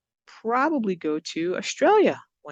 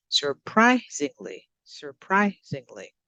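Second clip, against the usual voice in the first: surprisingly with PRI punched, said slowly; careful, slow demo of sur-PRI-singly; stressed syllable demo; slower than usual. Shown as a demonstration. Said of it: "let's look at where we stress the word".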